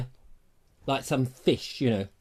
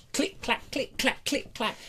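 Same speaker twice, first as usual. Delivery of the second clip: soft fencing click sounds; 6 semitones higher, 8 dB quieter, slower than usual. Retold or imitated. Sword fencing noises.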